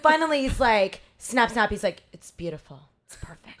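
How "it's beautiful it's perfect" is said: very soft, trailing into a whisper; low, breath-only admiration; hushed awe. Retold or imitated. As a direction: murmur it in mock reverence. Retold photographer admiring his shot.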